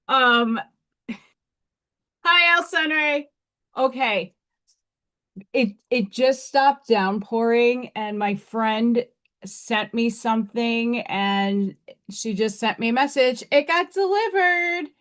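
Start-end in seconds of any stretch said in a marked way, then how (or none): none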